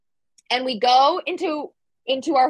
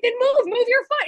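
panicked urging; 12 semitones higher than usual. Retold or imitated. Urging frightened boyfriend.

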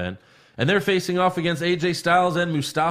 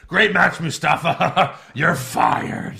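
loud boss greeting that turns abruptly; Vince; 3 semitones higher, 3 dB louder, much slower than usual. Retold or imitated. Host mimics the boss backstage firing a wrestler, blustering. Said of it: "Vince was like".

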